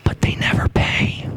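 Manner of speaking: hushed whisper, slowed. Real, not acted. Pastor telling the congregation the twist, whispered. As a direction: let it drop to a secret. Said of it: "whispered aside after the warning".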